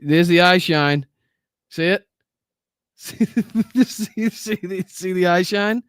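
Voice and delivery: ordinary talk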